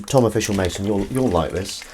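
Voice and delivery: normal talking voice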